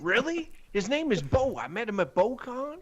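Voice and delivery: mock American. American quizzing a Canadian.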